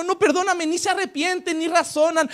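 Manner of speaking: usual speaking voice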